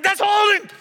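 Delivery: sharp high yell at the referee. Retold or imitated. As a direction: shout it at the screen like a fan. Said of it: "She yells at the T V like me".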